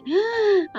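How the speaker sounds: high-pitched acted gasp; amazed gasp. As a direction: suck in breath in mock amazement. Amazed reaction to brother's words. (வியப்பில் மூச்சிழுப்பு)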